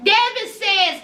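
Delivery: normal talking voice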